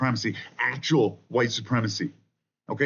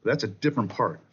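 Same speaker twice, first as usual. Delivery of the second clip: ordinary delivery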